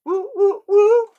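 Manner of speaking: very high, very loud whoops; mock fan whooping in the middle of church. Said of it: "someone else in the person going".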